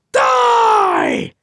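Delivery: high, drawn-out battle yell. Acted. Hothead yelling while throwing his ball in a test. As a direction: scream it out with full force. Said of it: "throws his ball while yelling".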